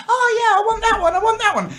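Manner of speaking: put-on high, fast childish pleading with a laugh; whiny kid voice. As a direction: squeal like a greedy little kid at a buffet. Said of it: "Little children stood there going".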